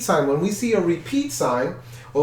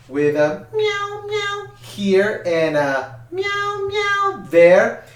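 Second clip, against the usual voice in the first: high meowing sung words, much higher; cat impression; 12 semitones higher, slower than usual. Shown as a demonstration. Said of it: "that's my best cat impression".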